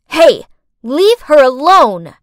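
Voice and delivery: loud, slow defiant yell; shouting. Acted. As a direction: call it out to stop them. Standing up to bullies.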